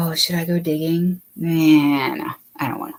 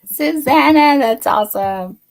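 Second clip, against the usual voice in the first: laughing out loud; 7 semitones higher, 5 dB louder than usual. Real, not acted. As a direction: burst out laughing. Laughing at comment.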